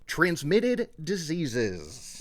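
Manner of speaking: "transmitted diseases" drawn out very slowly, each word stretched; slow mocking quote. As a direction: spell out "transmitted diseases" slowly, as if quoting something absurd. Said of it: "and has, quote".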